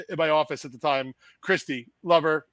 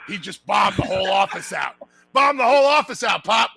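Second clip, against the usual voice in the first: retold yelling; 2 semitones higher, 5 dB louder than usual. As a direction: yell the bad news at Pop. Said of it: "You know, I'm yelling".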